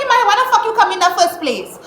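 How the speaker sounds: high, fast indignant outburst; exasperated. Retold or imitated. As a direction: fire the question back in exasperation. Venting retold exasperation at him.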